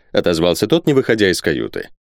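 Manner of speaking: plain reading voice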